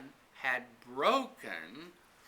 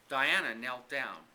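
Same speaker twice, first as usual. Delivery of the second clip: ordinary delivery, nothing marked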